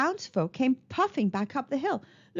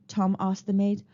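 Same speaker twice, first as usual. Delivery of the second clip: ordinary delivery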